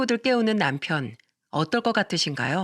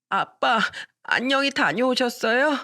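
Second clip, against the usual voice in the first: put-on child voice; 5 semitones higher than usual. Child greeting father.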